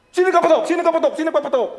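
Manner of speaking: loud, high repeated yell, fading; mock angry father yelling; father's shout. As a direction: keep shouting the question at the crowd. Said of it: "Sabi ng tatay ko".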